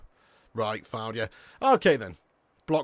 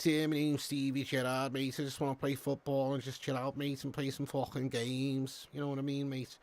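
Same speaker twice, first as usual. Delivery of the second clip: Steven Gerrard; 7 dB quieter than usual. Acted. Streamer takes a chat request to speak like Gerrard, soft Scouse accent. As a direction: mumble it softly like a Scouse footballer. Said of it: "Speak like Gerrard".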